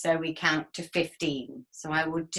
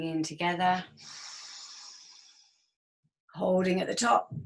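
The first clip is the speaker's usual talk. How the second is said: guided meditation. Acted. Leads a guided breathing exercise.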